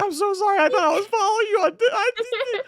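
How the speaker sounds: high, loud whiny apology; valley girl. Retold or imitated. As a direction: gush it out in a squeaky voice. Retelling a frantic apology DM.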